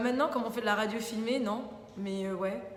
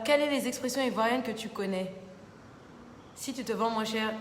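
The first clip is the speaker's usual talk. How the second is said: ordinary delivery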